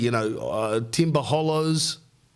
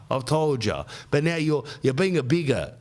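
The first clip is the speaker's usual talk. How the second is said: ordinary talk, nothing marked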